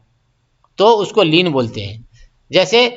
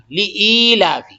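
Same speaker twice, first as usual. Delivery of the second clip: لی ایلاف قریش drawn out very slowly; teacher's slow demonstration; slow model reading; much slower than usual. Shown as a demonstration. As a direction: recite it slowly for students to copy. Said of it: "تو لے ایلاف نہیں ہے".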